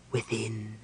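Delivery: low, soft ghostly answer; cold spectral voice. A narrator's reading. The spectre directs them through the door, coldly. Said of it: "said a cold voice almost in his ear".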